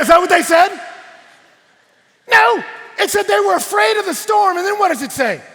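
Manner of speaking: very high, very loud, fiery delivery; shouted preaching. Acted. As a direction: shout the argument at the congregation.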